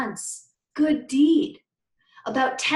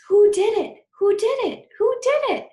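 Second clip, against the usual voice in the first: put-on excited kids; 10 semitones higher, 8 dB louder than usual. Kids whispering, voiced excitedly.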